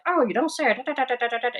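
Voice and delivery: put-on high old Hollywood transatlantic accent; mock a transatlantic accent like old movies. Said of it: "the transatlantic accent".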